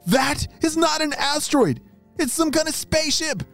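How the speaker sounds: high startled voice; shocked realization. Acted. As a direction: realize it's a ship. Realizes it's a spaceship.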